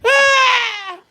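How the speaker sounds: high, sudden scared cry; startled shriek. Real, not acted. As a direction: yelp in real fright. Startled by the shout.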